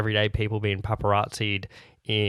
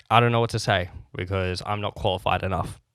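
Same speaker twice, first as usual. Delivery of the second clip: ordinary talk, nothing marked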